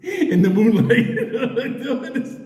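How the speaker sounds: giggling through the words; can't keep a straight face; laughing. Real, not acted. Telling about Central Park at night: laughing through it.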